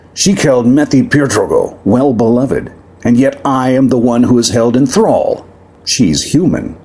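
a lightly voiced proud demon prince, aggrieved. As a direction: speak as a wronged demon noble to the crowd.

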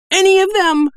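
terrified merchant squeaking at bandits; squeaked. A narrator's reading. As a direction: squeak it out in panic. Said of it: "Doll squeaked in a voice at least two octaves higher".

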